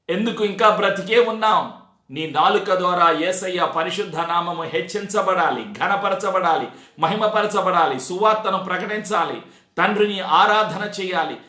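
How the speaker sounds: ordinary delivery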